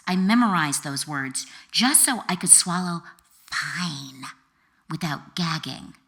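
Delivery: usual speaking voice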